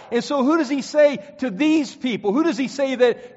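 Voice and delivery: ordinary delivery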